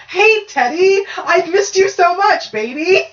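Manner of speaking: high, drawn-out gushing greeting; gushing granny ghost. Acted. Granny ghost greets a long-missed grandchild, gushing.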